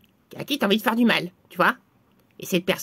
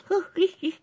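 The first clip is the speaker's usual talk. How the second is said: soft, high sneaky laugh; gleeful laugh; 7 semitones higher, 5 dB quieter than usual. Acted. Spell caster sees the curse works, gleeful high laugh. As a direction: laugh softly, pitched up, enjoying the mischief.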